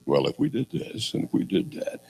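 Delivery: hushed mock-planning mumble; sarcastic. Preacher mocks leaders planning instead of fighting, sarcastic. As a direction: softly parody a dithering strategist. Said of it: "Did you hear the sarcasm in that?".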